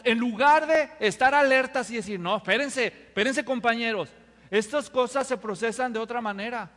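loud urgent call to wait; mock protest.

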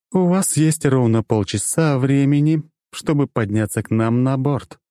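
slow, commanding woman's voice. A narrator's reading. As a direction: issue a cool female ultimatum. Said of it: "женский голос".

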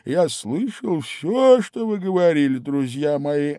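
slow, drowsy Dormouse; sleepy Dormouse. A narrator's reading. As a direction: drawl it out just woken. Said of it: "хриплым от сна голосом".

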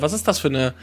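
ordinary talk, nothing marked

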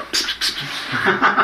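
slow, voiceless psst-psst to call someone; hissing psst. Retold or imitated. Mock hissing psst aimed at a hovering waiter. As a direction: hiss psst psst like shooing a waiter away. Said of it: "I don't go".

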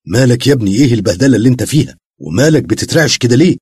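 loud, fast worried questions; alarmed questioning. A narrator's reading. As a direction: rush to ask what happened. Shawqi alarmed at Murtazaqa. (قلق وانزعاج)

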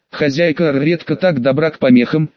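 plain reading voice

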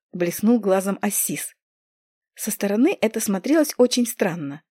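usual speaking voice

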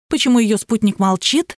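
ordinary delivery, nothing marked

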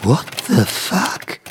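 slow, hushed disbelief; stunned whisper. Acted. Writer sees invisible fingers type, whispering. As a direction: breathe it out in shock.

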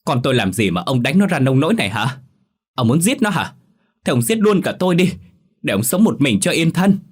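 high, loud screaming at her husband; furious scolding. A narrator's reading. Mother screams at husband over beaten daughter, furious. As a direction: rip into him, voice climbing. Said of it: "chửi như tát nước vào mặt".

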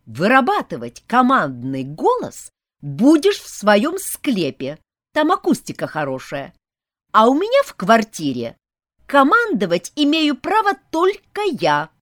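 loud, indignant retort. Acted. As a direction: tell him off loudly, outraged. Heroine tells vampire off.

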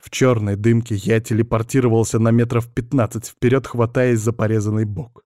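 ordinary delivery

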